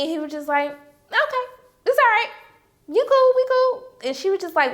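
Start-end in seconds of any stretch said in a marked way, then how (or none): 0.7-3.8 high-pitched mock reassurance; dismissive husband imitation. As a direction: brush it off in a put-on high voice. Voices husband's awkward casual forgiveness.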